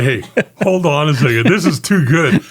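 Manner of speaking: can barely get it out for laughing; amused disbelief, voice lifted. Retold or imitated. Laughing at the prank call.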